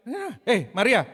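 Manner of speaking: lightly voiced brash greeting; rough casual Jesus. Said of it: "saya bayangin deh". (panggilan cuek dan keras)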